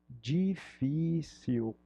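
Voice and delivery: drawn-out Portuguese difícil; slow, stretched out. Shown as a demonstration. Teacher stretching difícil for the class. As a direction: say difícil very slowly, syllable by syllable. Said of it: "Olha só como é parecido".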